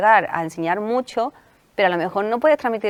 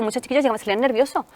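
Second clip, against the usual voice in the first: ordinary talk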